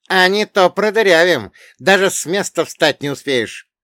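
high, loud menacing warning; loud threat. Acted. Third gunman backing up his friend, loud threat. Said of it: "сообразил угрозу третий".

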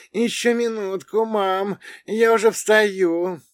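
put-on high-pitched drowsy girl's voice; drawling sleepy girl. Sleeping blonde mistakes him for mom, drowsy drawl. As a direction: plead to stay in bed, very high. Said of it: "протянула блондинка".

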